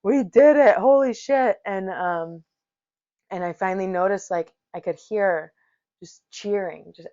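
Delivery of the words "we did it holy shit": high, loud, slow disbelieving cheer; gasping out the victory; high triumphant yell, still out of breath; elated relief. Retold or imitated. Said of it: "just like".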